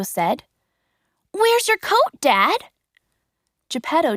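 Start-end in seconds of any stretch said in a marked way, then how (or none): 1.3-2.7 put-on high worried boy's voice; boy's question. Pinocchio asking Geppetto about his coat, high. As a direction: ask it high and anxious.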